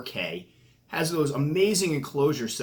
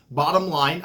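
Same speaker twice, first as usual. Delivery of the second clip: usual speaking voice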